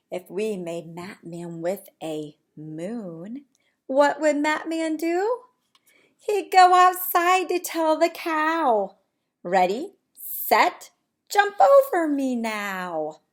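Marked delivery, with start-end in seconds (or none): 3.9-13.2 bright, lively storybook reading; animated read-aloud. Acted. Teacher reading to her class, lively storybook voice. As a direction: read it big for the little ones.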